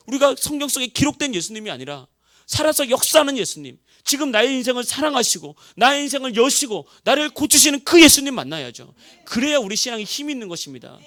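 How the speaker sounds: loud, high, fervent preaching; rising revival-sermon fervor; preaching at full force. Acted. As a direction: drive it home to the congregation. Preaching climax.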